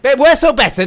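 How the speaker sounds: loud, high call to the crowd; rousing shout. Acted. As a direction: whip up the audience. Audience call and response.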